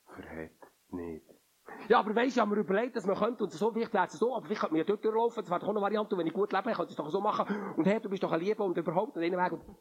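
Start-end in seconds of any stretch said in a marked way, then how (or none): 1.9-9.8 quick, higher voice talking oneself into a way out; zweifelnde innere Stimme. Acted. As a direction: play the inner voice that bargains and reasons fast. (schnelle, höhere Stimme des zweifelnden Verstands)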